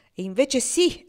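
loud, high insistence; he insists with force; forcefully. Acted. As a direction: hammer it home. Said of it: "ripeté lui con forza".

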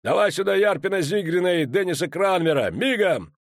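dwarf orders his officers summoned; barked order. A narrator's reading. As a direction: call them here at once. Commander calling for officers.

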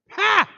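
a sharp high gloating "Ha!"; short high triumphant laugh. Acted. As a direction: crow over the fallen brother. Brother pushes him down well.

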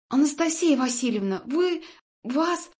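high, loud, breathless stammer; out of breath. A narrator's reading. Nurse bursts in to tell the patient news, breathless and agitated. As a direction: break off as if winded from running. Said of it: "пытаясь отдышаться".

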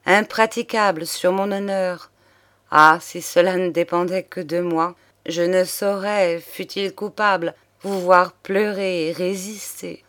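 ordinary delivery

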